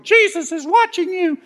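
very high, screeching bird voice; screechy parrot. Retold or imitated. Parrot squawking at burglars, very high. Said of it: "a parrot was on the perch going".

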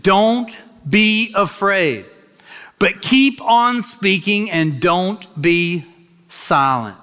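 slow, lifted preaching read; preaching scripture. Acted. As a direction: draw out each word of the verse. Preacher reads scripture with force.

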